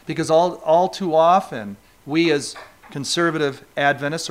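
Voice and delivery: usual speaking voice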